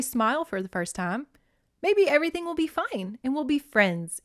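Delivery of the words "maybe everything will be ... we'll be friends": bright, hopeful, pitched well higher; happy hopeful. A narrator's reading. Erica hoping Kari will be her friend, happy. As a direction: say it like a girl who thinks she's found a friend. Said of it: "Erica thought happily".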